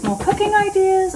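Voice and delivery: silly high voice. Shown as a demonstration. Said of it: "I don't know why I did that".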